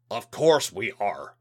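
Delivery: a hint of a menacing alien; menacing alien official. Alien liaison answers.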